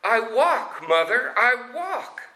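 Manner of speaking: put-on high, thrilled boy cry; joyful cry. A narrator's reading. Amal cries to his mother that he walks, high and thrilled. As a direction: shout the wonder high. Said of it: "He cries out".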